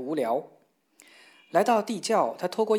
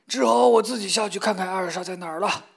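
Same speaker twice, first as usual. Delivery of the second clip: grumbling father; 3 semitones higher, 5 dB louder than usual. Acted.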